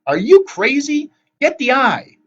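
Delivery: high, loud, urgent order; exasperated shout. A narrator's reading. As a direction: shout it in disbelief. Annabeth snaps back in panic.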